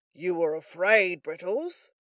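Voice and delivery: loud, slow character voice; pompous taunting Giles. A narrator's reading. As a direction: taunt your companion with it.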